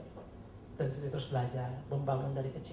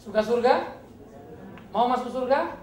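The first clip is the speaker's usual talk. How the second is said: louder repeated question; 5 semitones higher, 7 dB louder, slower than usual. Real, not acted. Preacher to hesitant congregation, louder call. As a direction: ask again much louder. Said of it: "Kok agak hati-hati jawabnya ya?".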